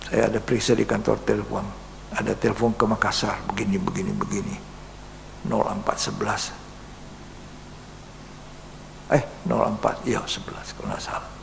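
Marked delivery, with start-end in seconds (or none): 2.1-11.3 whispered, softer confidential telling; hushed whisper. Real, not acted. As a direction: drop to a secretive whisper. Recounting secret phone calls. (berbisik seperti rahasia)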